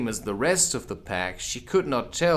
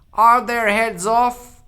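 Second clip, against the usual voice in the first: much higher, louder, slow shout; shouting Queen; 11 semitones higher, 10 dB louder, slower than usual. Acted. The Queen shouts to the soldiers. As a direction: shout across the garden. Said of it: "shouted the Queen".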